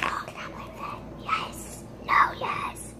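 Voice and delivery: whispered mock classmates; hushed quote. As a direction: whisper it like kids sneaking a question in class. Imagined classmates begging for the slime.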